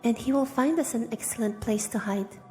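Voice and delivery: usual speaking voice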